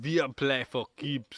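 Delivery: slow, drawn-out German accent. Shown as a demonstration. Second try at the film German's line, stronger German accent. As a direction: lay on the German accent, word by word. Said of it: "do in your German accent".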